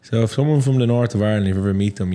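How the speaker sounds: normal talking voice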